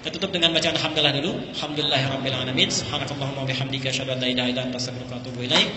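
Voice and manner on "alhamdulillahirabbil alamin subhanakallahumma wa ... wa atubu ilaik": low, even recited Arabic prayer; closing prayer recitation. Real, not acted. Preacher closing the gathering with hamdalah, prayer recitation. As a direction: recite the closing doa in a lowered, steady voice.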